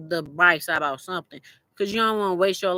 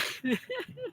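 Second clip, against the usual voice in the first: high squeal laugh; 12 dB quieter than usual. Real, not acted. Laughing at own remark.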